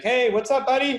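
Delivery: high breezy greeting; carefree buddy voice. Retold or imitated. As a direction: toss it off cheerfully. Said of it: "He's like".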